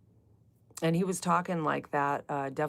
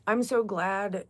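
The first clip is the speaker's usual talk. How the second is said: usual speaking voice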